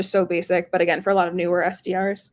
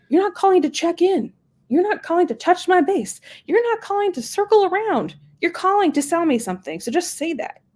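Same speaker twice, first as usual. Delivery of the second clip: higher, emphatic lecturing quote; quoting John Barrows; 10 semitones higher than usual. Retold or imitated. A sales coach telling reps to just say it, higher and emphatic. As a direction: drive the point home to salespeople. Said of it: "I get this from John Barrows".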